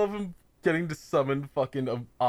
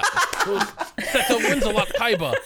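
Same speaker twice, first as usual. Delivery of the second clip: grand announcer name; 7 semitones higher, slower than usual. Pretend. Mock grand full-name announcement.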